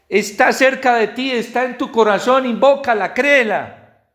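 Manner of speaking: high, loud, urgent preaching; fervent proclamation. Acted. Preacher quoting Paul to the congregation, fervent proclamation. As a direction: proclaim it high and loud, driving every phrase. Preaches Paul's letter with fervour.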